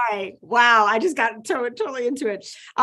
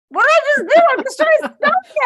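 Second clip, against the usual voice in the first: embarrassed laugh; 14 semitones higher, 7 dB louder, faster than usual. Real, not acted. Speller flubs word, embarrassed laugh.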